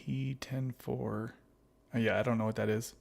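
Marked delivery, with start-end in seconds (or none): 0.1-1.4 slow, soft reread to oneself; reading to oneself. Real, not acted. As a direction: puzzle over it slowly under your breath. Rereads puzzling comment to himself.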